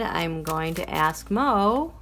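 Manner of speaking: normal talking voice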